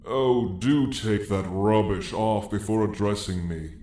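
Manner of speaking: normal talking voice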